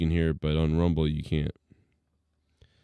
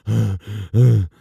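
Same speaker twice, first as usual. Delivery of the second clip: imitating a winded, gasping breath in; mock a man out of breath mid-rant; breathless gasp; 5 dB louder than usual. Streamer mocking the winded ranter, a loud imitated gasp. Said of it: "He's like".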